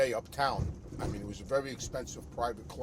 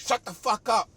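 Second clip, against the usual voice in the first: retold father yelling much higher and louder; imitating an enraged father's shout; furious yell; 9 semitones higher, 9 dB louder than usual. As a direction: bark it at the kid to shut him up. Father snaps at son in retelling.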